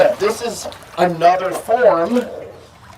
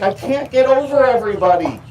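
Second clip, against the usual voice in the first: higher pitch, mild mock protest; lightly voiced complaining alligator; 6 semitones higher, 3 dB louder than usual. Missy stuck behind the other gators, mock complaining. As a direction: play a touch of helpless complaint.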